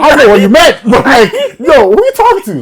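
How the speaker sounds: high, loud offended challenge; indignant retort. Retold or imitated. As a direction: bristle back at the rude man. Retold reply to rude man.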